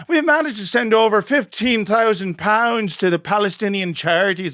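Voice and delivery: hammed-up Derry accent reading a knitters' letter. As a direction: read the letter as a cheery Derry lady. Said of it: "in this authentic Derry accent".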